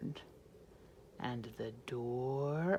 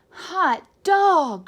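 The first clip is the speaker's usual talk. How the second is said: much higher, much louder cry; excited cry; 10 semitones higher, 11 dB louder than usual. Acted. Kids cheering their teacher's return, high and loud. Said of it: "cried the kids".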